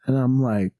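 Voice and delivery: ordinary talk, nothing marked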